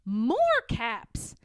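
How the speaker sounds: ordinary delivery, nothing marked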